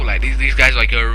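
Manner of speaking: ordinary delivery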